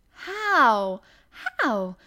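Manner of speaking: loud, high, impulsive cry; excited girl calling out with falling swoops; eager cry. A narrator's reading. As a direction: burst out with it, forgetting caution. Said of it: "cried Mary, forgetting to be careful".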